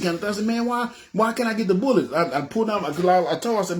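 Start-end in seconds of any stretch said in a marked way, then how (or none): 0.4-2.1 high-pitched, pressing complaint; indignant protest. Retold or imitated. Customer refused bullets at the counter, indignant. Acted argument with store clerk.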